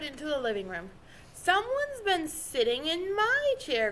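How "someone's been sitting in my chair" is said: grumbling Papa Bear. A narrator's reading. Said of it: "grumbled Papa Bear".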